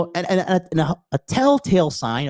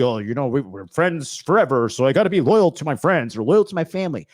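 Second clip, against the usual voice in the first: lightly mocking someone's loyalty excuse; mocking quote. Speaker parroting excuses for keeping miserable friends, lightly mocking. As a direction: mimic their excuse with disdain.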